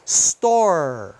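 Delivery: "Store" drawn out, ending on r; silent final E. Shown as a demonstration. Teacher modelling slowly for learners, silent E in "Store". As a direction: end "Store" on the r, no ee. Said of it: "não se pronuncia no final".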